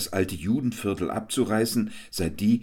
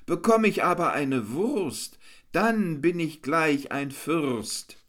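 a hammed-up pitiful moan in verse; whiny declaiming; 9 semitones higher than usual. A narrator's reading. Jester whines his hungry rhyme to the crowd, hammed-up. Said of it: "deklamiert mit weinerlicher Stimme".

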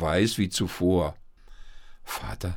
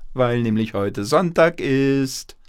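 loud, higher-pitched grandma call; 5 semitones higher, 7 dB louder than usual. A narrator's reading. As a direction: call out the teasing punchline. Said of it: "rief die Oma ins Bad". (laut und neckend rufen)

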